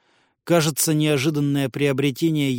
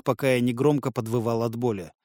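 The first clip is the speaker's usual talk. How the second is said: plain reading voice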